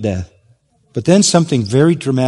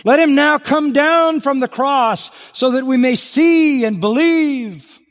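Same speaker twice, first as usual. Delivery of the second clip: much higher jeering dare, slowing at the end; sarcastic taunt; 12 semitones higher than usual. Retold or imitated. Preacher voicing Sanhedrin members taunting, high and sarcastic. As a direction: taunt him to prove himself. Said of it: "You can see the sarcasm there".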